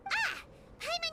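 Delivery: a quick high startled "Ah!"; sudden realization. Acted. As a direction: caught on, blurting out the discovery. Paimon realises Eula's trick.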